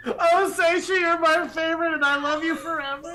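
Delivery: loud, high delighted gush; shower her with joyful love; gushing praise. Real, not acted. Player gushing to GM.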